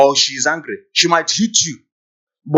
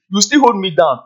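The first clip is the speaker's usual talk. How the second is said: ordinary talk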